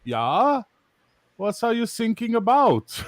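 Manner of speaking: put-on puzzled, slow German accent rising high; German coast guard. Young German guard misunderstands sinking sailor, slow puzzled German accent. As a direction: ask innocently in a German accent. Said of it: "this is the German Coast Guard".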